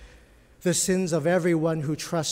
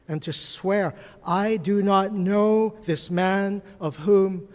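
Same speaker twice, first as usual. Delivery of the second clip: measured, dramatic Bible reading to the congregation; a preacher reading the denial of Peter aloud; scripture reading; 4 dB louder than usual. Acted. Reads Mark passage to church.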